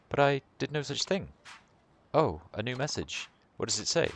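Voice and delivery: a hint of a distressed mother, lilting; lightly voiced worried mother. Worried mother asking where Takuya is, lightly voiced distress. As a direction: play the anxious mother, lightly.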